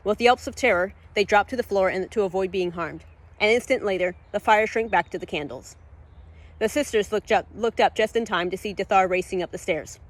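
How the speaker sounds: plain reading voice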